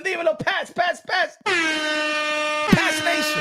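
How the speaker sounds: high excited shout, last words stretched out long; hype shout-out. Real, not acted. Streamer hyping up a fan in chat, stretched shout. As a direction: call out the name like an announcer.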